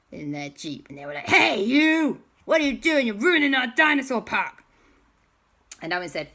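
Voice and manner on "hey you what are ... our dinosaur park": loud, high-pitched confrontational shout; angry yelling. Acted. Park owners challenging intruders in the dinosaur park, angry yelling. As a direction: yell at intruders ruining your place. Mean guys yell at intruders.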